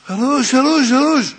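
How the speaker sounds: loud, high cries of "my head"; acted shouting. Leader pretending illness shouts about his head, loud high cries. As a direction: shout out the pain in your head. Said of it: "מתחיל לצעוק".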